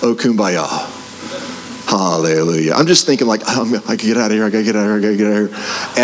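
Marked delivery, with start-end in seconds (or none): 0.0-2.7 lower, softer mock piety; a mock calm hallelujah; mock serene. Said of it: "you're not like". 3.5-6.0 hushed panic. Retold or imitated. Retold panic in room.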